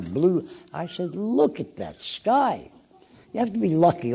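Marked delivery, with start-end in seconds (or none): 1.2-2.7 slow, drawn-out look at that sky; marveling slowly at the sky out the window; slow wonder. Retold or imitated. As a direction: let the idea dawn as you look up. Inspiration seeing the blue sky.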